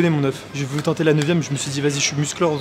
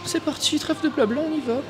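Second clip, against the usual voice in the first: much higher voice, rallying to start; high-pitched kick-off; 13 semitones higher than usual. Real, not acted. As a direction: jump up high to launch the set. Psyching himself up before lifting. (voix haut perchée pour lancer la série)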